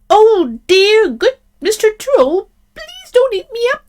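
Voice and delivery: tiny, very high pleading goat; very frightened. A narrator's reading. Frightened little goat begging the troll, high-pitched plea. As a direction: beg for your life in a squeaky little voice. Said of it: "he was very much frightened".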